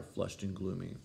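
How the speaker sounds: plain reading voice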